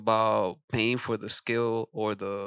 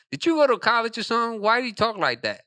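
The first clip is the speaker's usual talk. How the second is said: high, nosy quoted question; raised pitch, mock puzzlement; incredulous questioning; 9 semitones higher, faster than usual. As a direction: imitate someone demanding an explanation. Voices the man questioning his speech.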